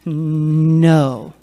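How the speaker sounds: long, loud, level no; firm refusal. Shown as a demonstration. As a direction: practice saying no in the mirror. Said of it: "Say no".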